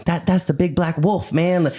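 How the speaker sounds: high-pitched, funny storyteller imitation; comic high-voiced quote; Wade impression. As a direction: mimic a friend's funny way of talking, pitched well up. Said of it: "the way he says it so funny".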